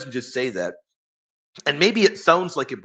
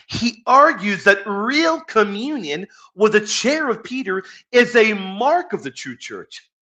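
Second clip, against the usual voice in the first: louder and higher, slowed to drive the point home; emphatic excitement; 7 semitones higher, 6 dB louder than usual. Real, not acted. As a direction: preach the point with fire. Stressing key point of lesson.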